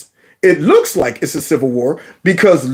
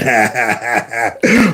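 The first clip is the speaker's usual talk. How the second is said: laugh; 9 semitones lower than usual. Real, not acted. Laughing at the memory.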